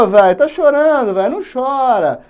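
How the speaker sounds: loud, high jeering; mocking warriors. Warriors taunting Juca for crying. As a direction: tease the crying prisoner.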